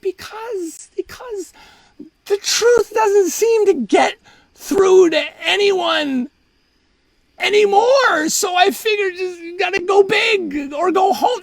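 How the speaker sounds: high wail, swelling from small to loud; hammed-up sobbing. Explaining the lie to reporters, high hammed-up sobbing. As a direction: blubber the excuse in a high, slow cry. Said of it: "And I'm just sobbing".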